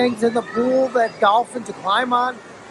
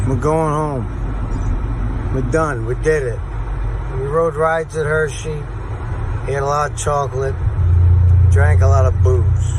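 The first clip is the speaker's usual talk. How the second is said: much lower, worn-out voice; tired; 9 semitones lower than usual. Real, not acted. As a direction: drag it out like you're spent. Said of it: "I'm tired".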